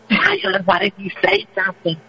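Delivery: normal talking voice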